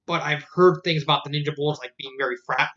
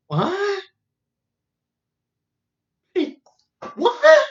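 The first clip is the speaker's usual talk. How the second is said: stunned disbelief; 14 semitones higher than usual. Retold or imitated. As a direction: squeal in total disbelief. Narrator's disbelief at the plan.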